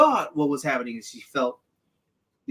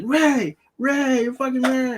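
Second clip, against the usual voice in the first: higher, drawn-out mock call for Rey; 10 semitones higher, slower than usual. Friend voicing Finn calling for Rey, higher slow mock call. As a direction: call her name like a worried friend. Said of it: "he's like".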